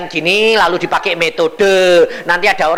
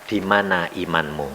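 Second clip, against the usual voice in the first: low, soft "Di mana imanmu?" as God asking; a calm, quiet divine question, "Di mana imanmu?"; quiet rebuke; 11 semitones lower, 8 dB quieter than usual. Retold or imitated. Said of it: "Tuhan berkata apa?".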